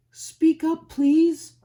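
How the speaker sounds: big elephant voice, calling down loud and high; Horton calling loudly. Acted. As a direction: call out to the tiny speck, booming. Horton shouts down to speck.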